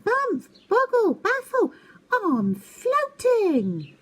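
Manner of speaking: high, loud, slow gloating; showing-off owl. Acted. Twit taunts his brothers as if floating, high and drawn out.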